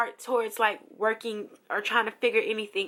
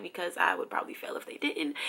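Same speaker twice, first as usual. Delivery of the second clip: whispered aside; 3 semitones lower, 6 dB quieter, faster than usual. Real, not acted. Thanking God to viewers for the study guide, whispered aside. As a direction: whisper it as a quick relieved aside.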